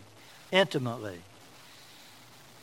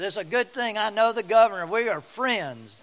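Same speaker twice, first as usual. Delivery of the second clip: boastful quote; 6 semitones higher, 5 dB louder than usual. Retold or imitated. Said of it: "finally he said".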